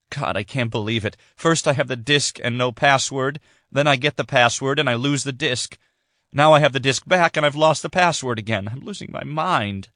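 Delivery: overwhelmed, at the end of her rope; exasperated despair. Acted. Sydney vents to her father about losing everything, exasperated.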